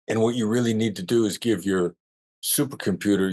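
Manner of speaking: normal talking voice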